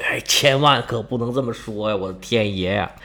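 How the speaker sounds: a touch of a hushed plea. Acted. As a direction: beg him under your breath. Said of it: "赶紧捂他嘴".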